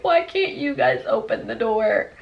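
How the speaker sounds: slow, mock-tearful plea; imitated terrified crying. Scared brother on the phone to police, mock crying. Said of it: "He's crying, terrified".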